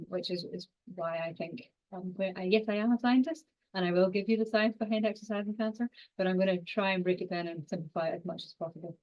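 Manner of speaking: usual speaking voice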